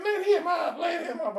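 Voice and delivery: put-on loud, very high-pitched girl in a Georgia accent; made-up Georgia accent. Girlfriend yells at the boy pinning her boyfriend, high Georgia-accented cry. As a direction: mimic a yelling schoolgirl with a put-on Georgia twang. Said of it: "she didn't have a Georgia accent".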